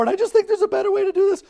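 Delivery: very high, rushed tearful whine; mock crying. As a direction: imitate an upset caller wailing her complaint fast. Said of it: "and she's crying".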